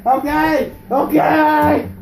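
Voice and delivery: loud, stretched-out pleading shout; yelled surrender. Real, not acted. Pinned wrestler giving in, yelling. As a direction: holler that you give up.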